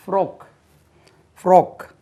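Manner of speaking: ஃப்ராக் as "frok", short rounded o, high; crisp teacher's model of ஃப்ராக் with a short o; correct pronunciation. Shown as a demonstration. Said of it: "உச்சரிப்பை கவனிங்க".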